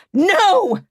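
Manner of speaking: sharp high loud shout; yelled no. Acted. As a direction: cut her off with a shout. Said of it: "Hannah yelled".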